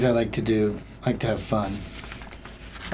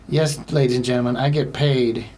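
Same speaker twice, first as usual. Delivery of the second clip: normal talking voice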